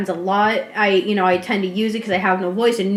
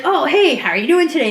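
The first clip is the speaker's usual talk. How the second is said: higher, livelier mock greeting; over-eager greeting; 5 semitones higher, 4 dB louder than usual. Imagining walking up to a deaf stranger, over-eager greeting. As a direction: pretend to hail someone a bit too chirpily.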